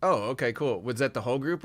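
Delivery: ordinary talk, nothing marked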